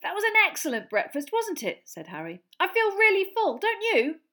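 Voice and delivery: high cheerful teasing. A narrator's reading. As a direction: taunt Dudley sweetly. Harry teasing Dudley.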